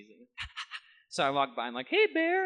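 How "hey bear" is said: high, bright hello to the dog; pitched-up hello to a pet; cheery hello to a dog. Retold or imitated. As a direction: greet the happy dog in a high voice. Greeting the happy dog.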